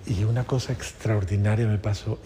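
ordinary talk

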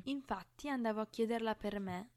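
plain reading voice

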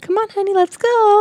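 put-on high, chirpy coaxing to a child; sing-song coaxing a kid along; eager parent voice. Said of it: "I'd been like".

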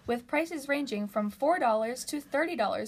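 upbeat radio ad read; booming prize announcer. Acted. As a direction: sell it like a salon commercial. Reads a salon radio ad.

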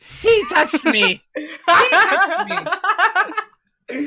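high, drawn-out mock accusation; mock outraged feminist. Comic mocks a feminist crying foul, hammed-up outrage. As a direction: wail it in hammed-up outrage, breaking into laughter. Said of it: "when a feminist runs backwards".

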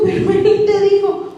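high-pitched but he told you; scolding the heartbroken girl in high disbelief; indignant disbelief. Retold or imitated. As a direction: throw it back at her in disbelief.

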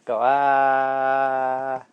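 ordinary talk

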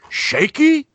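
high, loud, disbelieving squeal of a repeated word; incredulous mock outrage. Friend texting back mocking the word shaky, squealed high and loud. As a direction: ham up the friend's scoffing disbelief. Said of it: "And Frankie Hayduke texts me".

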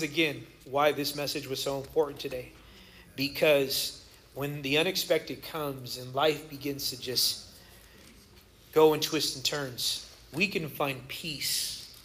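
low, soft, unhurried reassurance; hushed, earnest pastoral comfort; sincere, calm. Real, not acted. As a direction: settle down and speak gently of peace. Sincere turn to peace after comedy.

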